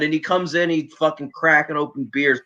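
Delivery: ordinary talk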